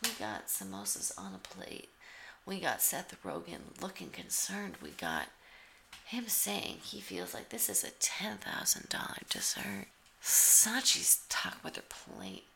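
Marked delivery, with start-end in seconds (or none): none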